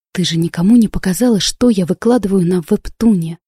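plain reading voice

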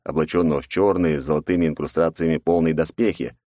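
ordinary delivery, nothing marked

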